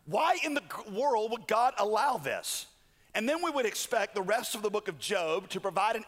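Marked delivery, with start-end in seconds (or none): 0.0-2.7 higher, bewildered protest; baffled outcry, slowing on the question; incredulous questioning. Acted. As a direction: ask it in stunned disbelief, pitch leaping up. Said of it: "you should be going".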